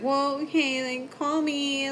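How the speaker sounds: put-on mild childlike sulk; high little-girl voice. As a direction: play a clingy kid agreeing. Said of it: "she's just like".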